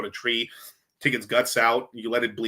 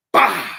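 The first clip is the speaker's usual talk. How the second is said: imitated gunshot; 6 semitones higher, 9 dB louder than usual. Shooting the deer.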